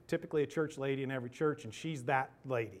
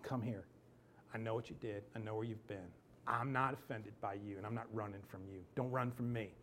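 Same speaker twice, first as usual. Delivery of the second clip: soft, tender invitation; softer, gentle call to come; Jesus gently calling a runaway home; 3 semitones lower, 8 dB quieter than usual. Acted. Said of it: "the Jesus that's going".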